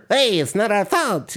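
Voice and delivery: high, put-on little gnome voice. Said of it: "they all talk like that".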